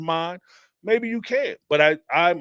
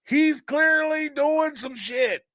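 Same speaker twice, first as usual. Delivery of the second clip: horror movie yell; 9 semitones higher, much slower than usual. Retold or imitated. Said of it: "if this was a horror movie, you'll be like".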